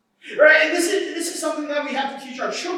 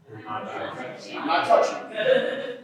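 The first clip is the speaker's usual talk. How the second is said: much lower, slow, breathy sing-song taunt; teasing taunt; 5 dB quieter, much slower than usual. Retold or imitated. Pastor as little brother taunting his sister, low slow tease. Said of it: "what would I say?".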